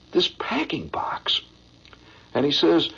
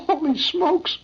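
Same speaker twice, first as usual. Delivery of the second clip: put-on awed kids; 11 semitones higher, slower than usual. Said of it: "all the kids sitting there looking".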